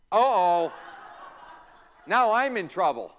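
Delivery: a hammed-up uh-oh, pretending to be scared; mock alarm. Teacher joking to the class about being in trouble, mock alarm.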